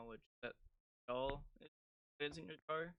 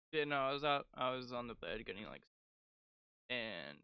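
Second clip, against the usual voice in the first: ordinary delivery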